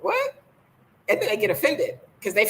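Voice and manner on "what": shocked squeak, much higher; a disbelieving high yelp; incredulous what. Retold or imitated. Speaker reacts to a marriage offer, high incredulous what. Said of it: "I'm just like laughing".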